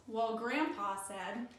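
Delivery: ordinary delivery, nothing marked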